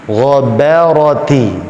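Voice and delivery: GHABARATI as "gho-bæ-ro-ti"; slow ghabarati, heavy gha and ra, light ba; alternating thick and thin. Shown as a demonstration. Teacher drilling an example word, thick-thin Ghabarati. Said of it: "Gha tebal".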